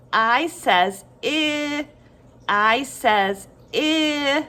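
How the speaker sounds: name I, then hold short I long; letter then sound. Shown as a demonstration. Teacher modelling for children, slow I says I with long "ihhh". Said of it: "Say it with me".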